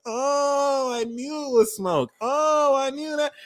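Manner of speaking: high, drawn-out "oh" of vindication; knowing realization. Acted. You realizing it was all smoke, knowing realization. Acts out the year's last realization.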